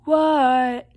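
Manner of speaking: loud, drawn-out yell back; long, stretched storybook shout; shouted reply. Acted. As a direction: holler it back across the house. Shouting back to yelling mother.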